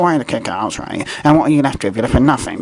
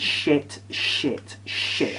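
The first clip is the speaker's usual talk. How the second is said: soft, evenly spaced repeats; disgusted verdict; 8 dB quieter, much slower than usual. Real, not acted. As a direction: drop each word quietly, one by one. Repeating contempt for the match.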